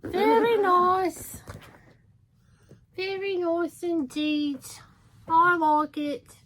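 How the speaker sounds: high, loud, slow mock British accent; terrible British accent. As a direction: ham up a bad British accent, drawn out. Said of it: "I'll do a terrible British voice".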